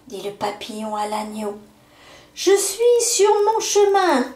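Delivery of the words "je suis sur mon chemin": high, loud, airy cry; calling out. A narrator's reading. Butterfly calling down to the lamb while leaving, high cry. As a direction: fling the words out as it flies off. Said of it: "cria-t-il".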